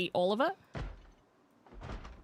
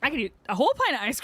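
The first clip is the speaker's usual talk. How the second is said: excited boast; 8 semitones higher, 3 dB louder, much faster than usual. Real, not acted. Boasting about ice cream.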